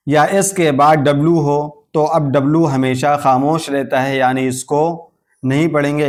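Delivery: ordinary talk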